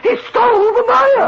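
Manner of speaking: high, loud repeat; outraged wail. Acted. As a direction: wail it in wounded fury. Designer repeating, rising outrage.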